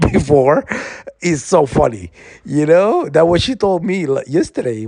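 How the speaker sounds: high, amused delivery breaking into laughter; laughing while talking. Real, not acted. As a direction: giggle through the words. Laughs at sister's retold line.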